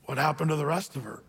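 normal talking voice

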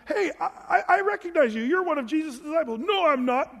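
high accusing voice. Retold or imitated. Accuser then Peter denying.

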